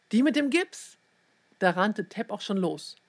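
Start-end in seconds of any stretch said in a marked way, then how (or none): 0.1-1.0 high, excited question; urgent call. A narrator's reading. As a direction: call it out, rising at the end. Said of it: "rief sie".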